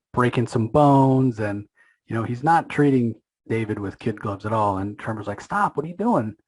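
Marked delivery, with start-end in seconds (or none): 5.4-6.4 higher-pitched, mildly voiced protest; retold outcry, pitched up; alarmed protest. Tremor pleads with Spawn to stop, mock alarmed protest.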